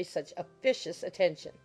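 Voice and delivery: ordinary delivery, nothing marked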